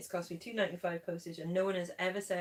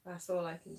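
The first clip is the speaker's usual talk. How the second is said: normal talking voice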